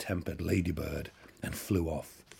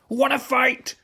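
challenging shout; 9 dB louder than usual. A narrator's reading. Ladybird picks a fight.

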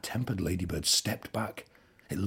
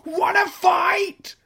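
challenging shout; 10 dB louder, much slower than usual. A narrator's reading. Ladybird picks a fight.

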